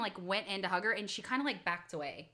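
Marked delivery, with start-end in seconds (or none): none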